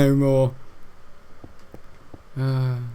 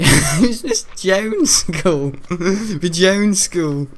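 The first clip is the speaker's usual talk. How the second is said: laughing; 4 semitones higher, 5 dB louder, much slower than usual. Real, not acted.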